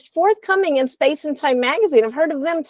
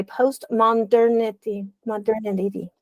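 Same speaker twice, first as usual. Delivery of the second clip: postmodernity said slowly, syllable by syllable; postmodernity drawn out as a tricky word to pronounce; careful sounding-out. Real, not acted. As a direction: work carefully through the hard word postmodernity, stretching it out. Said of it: "that's a hard word for me to say".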